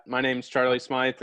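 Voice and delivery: ordinary delivery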